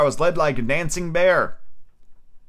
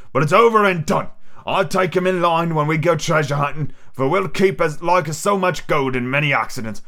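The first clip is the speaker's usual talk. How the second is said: pirate voice; 3 semitones higher than usual. Acted. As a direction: play Long John Silver boasting to his crew. Silver's pirate voice.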